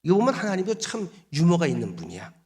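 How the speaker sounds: usual speaking voice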